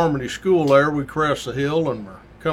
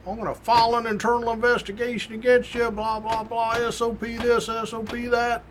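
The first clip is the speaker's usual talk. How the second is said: sergeant ripping them; 8 semitones higher than usual. Retold or imitated. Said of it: "he's just ripping them".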